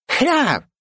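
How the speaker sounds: very high strangled squawk; shot pigeon squawk. A narrator's reading. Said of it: "издала звук подбитого голубя".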